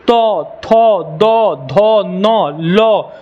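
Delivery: slow, separate तो, थो, दो, धो, tongue on teeth; drawn-out dental syllables तो, थो, दो, धो, नो, लो; dental sound drill. Shown as a demonstration. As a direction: hold each of तो, थो, दो, धो for learners to copy. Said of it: "बोल के ट्राई करो".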